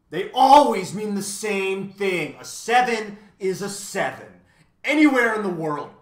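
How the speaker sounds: high, loud, emphatic lecture; loud math magician. A narrator's reading. Math magician insists to Milo, loud and high. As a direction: hammer home that numbers are universal.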